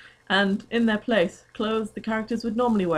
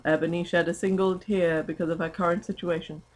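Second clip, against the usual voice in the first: normal talking voice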